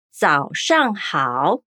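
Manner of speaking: 早上好 said very slowly, syllable by syllable; slow Mandarin. Shown as a demonstration. Teacher models good morning in Mandarin, slow and clear. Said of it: "repete mais uma vez".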